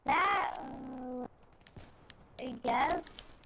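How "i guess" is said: normal talking voice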